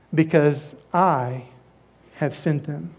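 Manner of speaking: slow, soft and low; soft low closing words of the Spirit; hushed reassurance. Acted. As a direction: let it settle gently like a promise. Spirit's quiet assurance.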